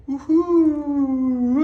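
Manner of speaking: high joyful cheer; excited whoop. Real, not acted. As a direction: let out a happy whoop. Arriving in hotel room, cheering.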